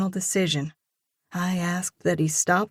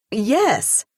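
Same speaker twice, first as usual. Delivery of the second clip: Yes punched higher and louder; emphatic yes; 6 semitones higher, 6 dB louder than usual. A narrator's reading. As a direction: hit the Yes firmly and decisively. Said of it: "I say emphatically".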